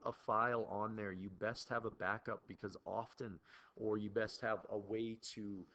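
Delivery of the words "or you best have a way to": normal talking voice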